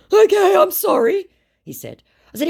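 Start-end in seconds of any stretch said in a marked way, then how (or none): 0.1-1.3 very high, loud put-on apology; mock apologetic scammer. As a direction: apologise in a squeezed-up pitch.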